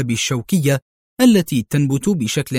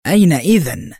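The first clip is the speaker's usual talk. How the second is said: higher, mildly raised voice; exclaimed question; 4 semitones higher, 4 dB louder than usual. A narrator's reading. Matthew demanding where the fugitive is, mild shout. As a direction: call out the question, a little louder. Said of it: "صاح ماثيو".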